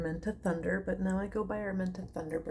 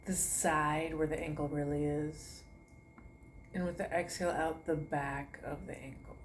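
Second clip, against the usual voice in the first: guided meditation; 5 dB quieter than usual. Acted. To her online class, soothing meditation guidance. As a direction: talk them gently from toe to foot. Leads a toe-to-head relaxation.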